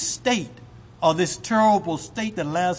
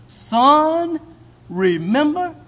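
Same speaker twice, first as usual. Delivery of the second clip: higher, louder solemn address; Abraham's reply; 9 semitones higher, 5 dB louder, slower than usual. Acted. Abraham reminding the rich man, solemn raised voice. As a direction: say remember gravely. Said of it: "this is Abraham's reply".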